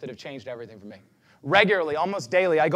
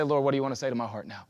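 softer, hushed plea to God; lowered voice asking the Lord; quiet prayer; 4 semitones lower, 5 dB quieter than usual. Retold or imitated. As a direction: ask gently and let it fade. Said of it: "and I say".

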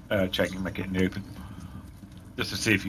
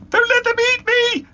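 very high, loud, plaintive cry; plaintive high-pitched; 7 dB louder than usual. Acted. Dindy pleads to the orcs, very high and plaintive. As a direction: wail it in a tiny squeaky plea. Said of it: "in a plaintive, high-pitched voice".

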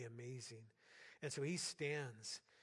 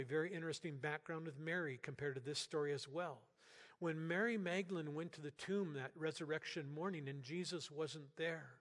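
ordinary delivery

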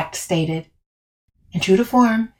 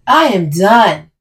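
slow, loud, emphatic vow; swearing off; 2 semitones higher, 6 dB louder, slower than usual. A narrator's reading. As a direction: swear it slowly and forcefully. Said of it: "Camille swears".

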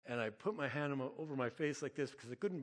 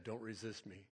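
a hint of a mystical voice, softer; lightly voiced dream voice, kept quiet; 3 semitones lower, 6 dB quieter than usual. Dream voice speaking to the terrified dreamer, softly mystical. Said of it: "I hear that voice again".

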